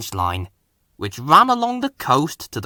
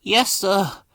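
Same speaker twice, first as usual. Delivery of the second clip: high, forlorn little engine; sad; 7 semitones higher than usual. A narrator's reading. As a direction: answer sadly in a small high voice. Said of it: "replied Percy sadly".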